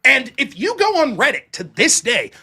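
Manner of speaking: usual speaking voice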